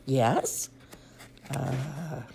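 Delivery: usual speaking voice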